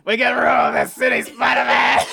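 loud, high, laughing Goblin shout; Green Goblin impression. Host yelling the Goblin line to friends, laughing. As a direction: shout it like a cackling villain. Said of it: "do our Green Goblin impression".

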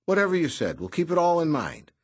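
breezy, all smiles; cheerful. A narrator's reading. Noonan cheerful.

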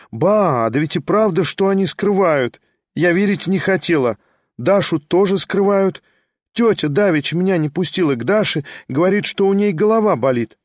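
lightly voiced young woman, wistful then eager; a hint of a lively young lady, lifted a little; young lady Liza. A narrator's reading. Liza, excited and higher.

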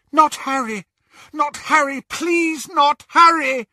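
high, loud, frantic begging; desperate pleading. Acted. Mother begging an intruder to spare her son, desperate. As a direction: cry out a terrified plea. Mother begs killer for child.

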